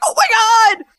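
high, loud startled shout; shocked cry. Retold or imitated. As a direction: blurt it out in sudden panic. Husband hears water broke.

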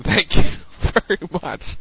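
ordinary talk, nothing marked

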